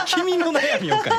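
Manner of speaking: high, slow words through real laughter; laughing. Real, not acted. Guest laughing as the host asks for advice, laughing. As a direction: laugh the words out at the host's joke.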